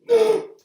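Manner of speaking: high, loud shocked gasp; sharp gasp. Retold or imitated. Viewers at a shocking scene, gasping loudly. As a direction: gasp like the twist just landed. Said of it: "where we're just like".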